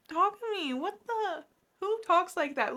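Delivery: high, swooping disbelief; indignant outburst at a fictional jerk, rising in volume; incredulous. Real, not acted. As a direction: react with scandalized, high-pitched disbelief. Outraged at a character's callous line.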